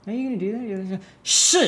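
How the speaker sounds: high-pitched, rising mock challenge; mock confrontation. Teacher hamming up anger for the drill, mock confrontation.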